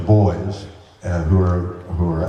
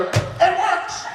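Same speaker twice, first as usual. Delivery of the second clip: mock cheer; 3 dB quieter than usual. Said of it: "I hear him go".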